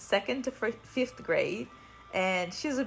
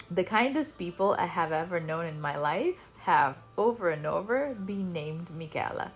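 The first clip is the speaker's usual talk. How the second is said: usual speaking voice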